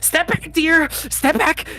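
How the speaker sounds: high, shrill crone warning; old witch voice. Acted. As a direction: shoo them back like a crone. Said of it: "And she's like".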